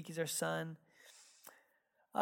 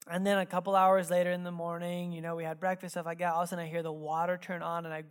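usual speaking voice

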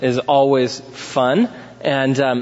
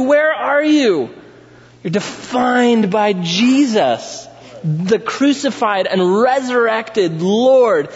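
full-force preaching; 11 semitones higher, slower than usual. Acted. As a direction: thunder it from the pulpit. Preaching Paul's words at full force.

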